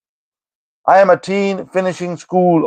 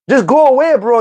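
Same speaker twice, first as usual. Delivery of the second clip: high, raised go away; exasperated shout; 6 semitones higher than usual. Real, not acted. Shooing a troll from chat.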